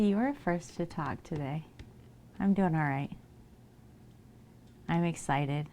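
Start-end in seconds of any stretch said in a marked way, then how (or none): none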